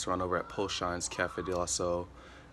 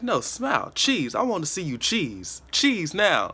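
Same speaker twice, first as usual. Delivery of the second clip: much higher and louder, demanding a real cheese; insistent mom; 10 semitones higher, 8 dB louder than usual. Retold or imitated. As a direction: push the kid, ever louder: cheese now. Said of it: "she's like".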